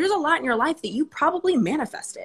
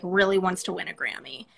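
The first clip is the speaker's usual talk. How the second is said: ordinary delivery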